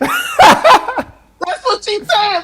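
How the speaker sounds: high, slow, laughing it out. Real, not acted. Host laughing while repeating her words, slow and high. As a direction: squeal the line through laughter.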